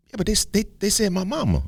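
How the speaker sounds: put-on high, stumbling kid's excuse; protesting grandson. Grandson excuses his fighting to granddaddy, protesting. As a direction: plead your case in a boy's high voice.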